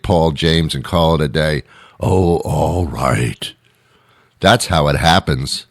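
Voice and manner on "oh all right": slow, put-on grudging voice; reluctant agreement. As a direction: give in with a drawn-out sigh of a line. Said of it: "partner gives in".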